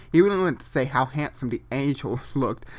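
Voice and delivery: ordinary talk, nothing marked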